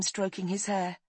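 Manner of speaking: ordinary delivery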